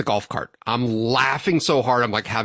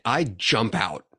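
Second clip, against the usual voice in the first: ordinary talk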